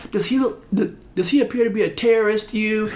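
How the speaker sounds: cussed out. Retold or imitated. Imitating the officer cussing him out over the injured recruit, mock furious. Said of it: "I'm getting cussed out".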